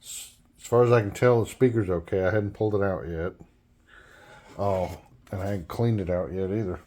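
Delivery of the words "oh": normal talking voice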